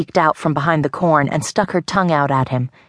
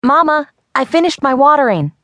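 called out high and louder; little girl calling to her mama; 10 semitones higher, 4 dB louder than usual. A narrator's reading. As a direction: call out happily across the farm. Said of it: "Libby called".

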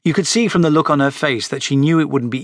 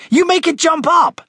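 loud declaration; 4 dB louder than usual. Acted. Frederick showing off on the skateboard, bossy high declaration. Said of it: "he declared".

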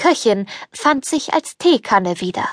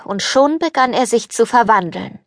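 plain reading voice

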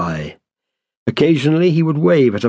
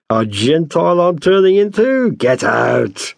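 mock indignation; 7 semitones higher than usual. A narrator's reading. Landlord mock-indignantly dismisses fawning tenant.

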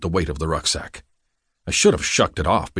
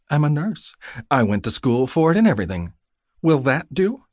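higher-pitched, mildly miffed retort; annoyed; 7 semitones higher than usual. A narrator's reading. Nurse defending her training to patient, mildly annoyed. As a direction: answer back with a pointed, lifted question. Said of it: "her annoyed tone".

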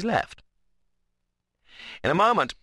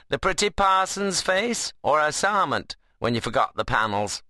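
higher-pitched teasing rustic voice; sarcastic; 7 semitones higher than usual. A narrator's reading. Ben mocks Seth over forgotten panels, sarcastic. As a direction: needle a workmate in dialect. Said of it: "by a success in sarcasm".

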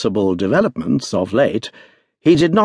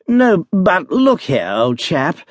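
higher, slow, coaxing plea; pleading; 6 semitones higher, slower than usual. A narrator's reading. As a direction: coax the doctor like an old friend. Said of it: "trying affectionately to capture the doctor".

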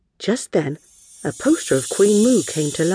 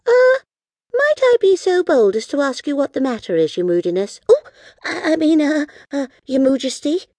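high-voiced, halting, over-formal politeness; hesitant young heroine; 11 semitones higher, 4 dB louder than usual. Girl asking the moody queen what's wrong, hesitant and high. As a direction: fumble politely for the royal title.

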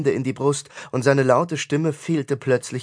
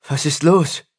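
alarmed, slightly raised question; urgent demand; 3 semitones higher, 4 dB louder than usual. A narrator's reading. Tristan runs to the priest who fell to his knees, urgent. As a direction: demand to know what is wrong.

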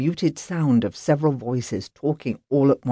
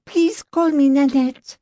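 high, gracious French lady; French accent, friendly correction; 13 semitones higher, 4 dB louder than usual. Acted. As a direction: insist gently in a French accent. Nanette correcting housekeeper.

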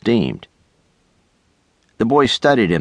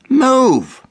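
sharp, much higher, louder demand; insisting shout; 4 dB louder than usual. A narrator's reading. Doug fighting his brother for the window seat, insistent shout. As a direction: bark it at the brother to shift. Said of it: "Doug insisted".

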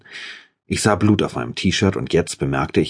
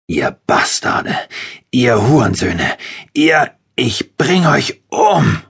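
slow, loud, rising into a much higher roar; furious threat; 8 semitones higher, 4 dB louder, slower than usual. A narrator's reading. To the torturers after seeing her wound, a slow furious roar. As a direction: spit out the insults, then roar the death threat. Enraged at his girlfriend's torturers.

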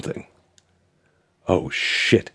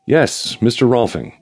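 crisp, businesslike, pitched up; all-business voice; 7 semitones higher, slower than usual. Acted. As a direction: keep it strictly professional. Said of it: "again using my all-business voice".

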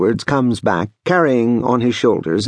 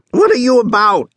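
high, loud indignant outburst; crying out; 14 semitones higher, 5 dB louder than usual. A narrator's reading. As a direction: cry out sharply, pitched way up. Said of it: "he cries".

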